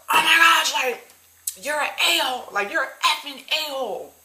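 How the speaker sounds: high-pitched mock rant, imitating her; mock screaming customer. Customer fed up at the counter, mock screaming. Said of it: "So she started screaming, getting mad".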